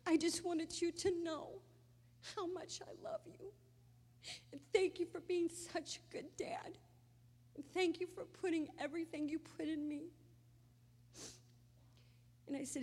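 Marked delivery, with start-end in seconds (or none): 0.1-10.1 high, breaking voice fading to a whisper; tearful goodbye. Retold or imitated. Daughter telling her dying dad she loves him, crying and whispering. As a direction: sob out the goodbye.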